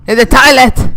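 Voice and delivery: loud, sharp shout of toilet; shouted repeat. Real, not acted. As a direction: yell it the second time. Repeats line, second time shouted.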